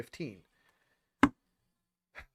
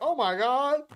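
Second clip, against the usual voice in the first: mock gasp; 7 semitones higher, 4 dB louder than usual. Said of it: "You're like".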